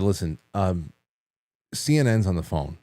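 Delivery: low, grave and hesitant; understated mock-serious tone; deadpan serious. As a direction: break fake news with a straight face. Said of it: "and I was very serious".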